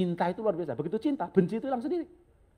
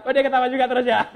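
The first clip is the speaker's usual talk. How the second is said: loud, high, fast remark through laughter; laughing tease; 8 semitones higher, 10 dB louder, faster than usual. Real, not acted. Speaker teasing a man who laughs too, laughing loudly. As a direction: gleefully call out a fellow laugher.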